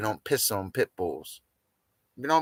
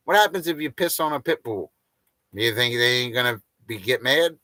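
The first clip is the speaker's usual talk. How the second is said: normal talking voice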